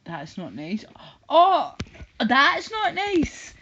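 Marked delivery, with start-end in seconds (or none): 1.3-3.5 loud, high, drawn-out mock protest; hammed-up offended wail; mock outrage. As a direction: throw the complaint back in an exaggerated high cry. Mockingly repeats the chatter's complaint.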